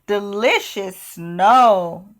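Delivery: slow, savoring delight. Acted. Elizabeth tastes the popcorn snow, slow savoring delight. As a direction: linger on each word.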